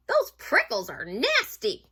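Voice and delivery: pained complaint. Acted. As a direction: exclaim indignantly over the painful prickles. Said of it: "he cried".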